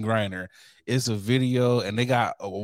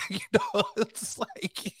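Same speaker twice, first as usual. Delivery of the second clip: laughing; 9 semitones higher, 4 dB quieter, slower than usual. Real, not acted. Laughing at own joke.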